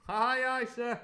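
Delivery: loud high answering call; cook's cry. Acted. The cook answering the Squire, a loud high cry. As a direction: call it back from the passage. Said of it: "cried the cook in the passage".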